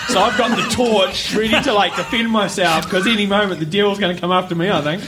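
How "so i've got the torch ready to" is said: ordinary talk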